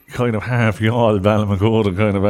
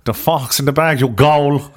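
raised, broad Limerick voice; mock a thick Limerick accent; higher, hammed-up Limerick banter; 5 semitones higher, 3 dB louder than usual. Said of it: "she had a nice Limerick accent".

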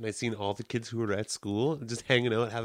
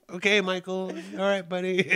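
higher, indulgent, patronizing brush-off; humoring reply; 7 semitones higher than usual. Retold or imitated. Boy brushing off his friend Michael, mock patient. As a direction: humour him like a weird kid.

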